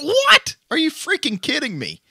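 high-pitched, incredulous outburst; stunned disbelief. Retold or imitated. Firefighter braced for a chewing-out, let off instead, stunned disbelief. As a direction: yelp it in shock, voice shooting way up. Acted shocked reaction to boss saying that'll be all.

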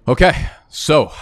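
winded, gasping after a fast rant; out of breath. Real, not acted. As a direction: push the words out on short breath. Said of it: "I'm out of breath".

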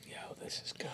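breathy whisper of delight. Real, not acted. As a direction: whisper it to yourself. Quiet aside after tasting.

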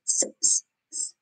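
s, s, s as a long voiceless hiss; hissing s sound. Shown as a demonstration. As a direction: hiss out s, s, s softly. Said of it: "S sounds like".

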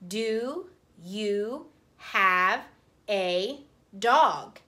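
words drawn out slowly as she writes; slow dictation. Shown as a demonstration. Teacher saying her sentence slowly as she writes it. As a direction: stretch each word while writing it. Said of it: "So I am going to write".

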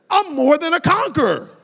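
loud, rising sermon cry; preaching at full force. Acted. Preacher urging the congregation to declare it aloud, full-force preaching. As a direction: preach it out to the crowd.

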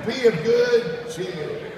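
drawn-out uplifting proclamation; slow preaching call. Acted. Preacher lifting the congregation: slow, high, loud call. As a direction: stretch each word out like a blessing.

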